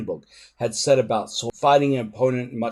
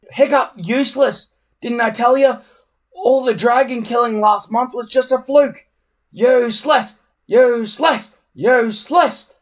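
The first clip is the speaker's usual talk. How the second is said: high, loud gloating jeer; gleeful crowing; 12 semitones higher, 8 dB louder than usual. A narrator's reading. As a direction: crow over his failure. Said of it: "crowed Snotlout gleefully".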